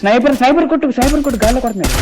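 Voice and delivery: high-pitched, repeated combat callout; urgent warning. Real, not acted. Urgent sniper warning to teammates. (ఆత్రంగా, ఎత్తైన గొంతుతో హెచ్చరిక)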